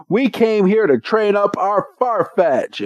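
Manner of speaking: a lightly voiced eager boy; earnest trainer. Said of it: "And he's like".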